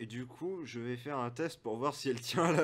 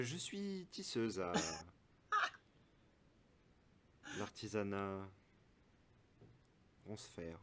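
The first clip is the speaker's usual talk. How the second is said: soft NPC woman; 5 semitones lower, 10 dB quieter than usual. Acted. NPC tells her trade. (voix douce et lente de la tisseuse)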